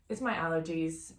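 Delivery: normal talking voice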